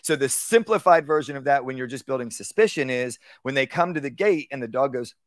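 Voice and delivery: ordinary talk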